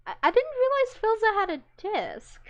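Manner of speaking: high, loud surprised exclamation; surprised reaction while browsing Filza's chest; surprised realization. Real, not acted. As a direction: blurt it out in sudden surprise. Sudden surprised discovery.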